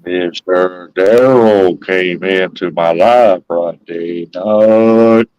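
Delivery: very slow, low, stretched-out words; exaggerated slow. Shown as a demonstration. Man told he talks too fast, teasingly slowed right down. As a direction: drag every word out low for a slow listener. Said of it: "Maybe you need to slow it down".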